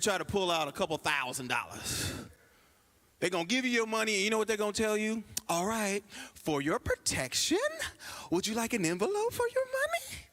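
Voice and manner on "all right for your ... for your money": high, sweet mock teller voice; lightly mock the polite teller; prissy bank teller. Teller offering a customer an envelope, high mock politeness.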